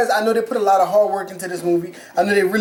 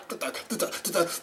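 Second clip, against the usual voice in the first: much softer mumbled filler syllables; mock babble; 4 semitones lower, 13 dB quieter, much faster than usual. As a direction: mumble nonsense under the breath. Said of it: "all of a sudden he was like".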